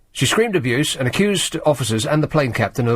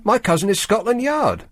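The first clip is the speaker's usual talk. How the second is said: quoted as scream; 8 semitones higher than usual. Retold or imitated. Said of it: "Campbell screamed".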